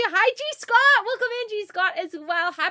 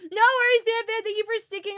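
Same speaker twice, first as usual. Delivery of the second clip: laughing; 6 semitones higher than usual. Real, not acted. Laughing to tears.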